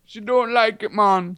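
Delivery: high-pitched Jamaican imitation; playful Jamaican Rasta accent; mock Jamaican accent. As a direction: put on a comic Jamaican lilt. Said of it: "talking like a Jamaican roster".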